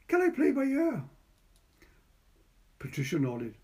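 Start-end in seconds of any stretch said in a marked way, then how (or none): 0.1-1.0 put-on high, loud child's question; boy's voice. A narrator's reading. Boy asking Patricia if he can play. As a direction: ask eagerly like a small boy.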